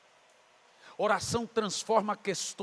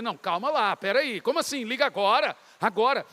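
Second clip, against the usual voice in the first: louder, startled objection; alarmed protest; 6 dB louder than usual. Retold or imitated. Retelling his reply when God says to call now, alarmed protest. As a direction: balk at a sudden order. Acted balking at God's order.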